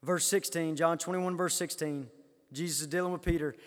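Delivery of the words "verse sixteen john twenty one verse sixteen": usual speaking voice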